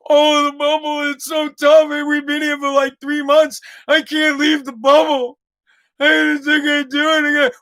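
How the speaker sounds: mock a pampered star moaning about the bubble; mocking whiny athlete. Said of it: "whining and moaning".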